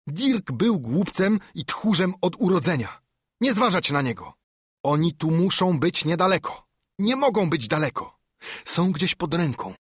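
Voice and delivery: high, agitated tirade; angry shouting. A narrator's reading. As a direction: berate the gang and push them to search. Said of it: "krzyczał".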